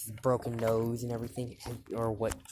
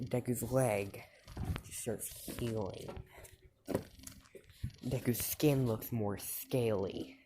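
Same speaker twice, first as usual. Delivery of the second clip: usual speaking voice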